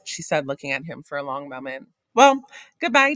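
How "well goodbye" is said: high, loud and far, a cold dismissal; pointed goodbye. A narrator's reading. Wife dismisses husband leaving for work, icy high goodbye. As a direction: send him off with a chilly flourish.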